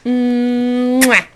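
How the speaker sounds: ordinary talk, nothing marked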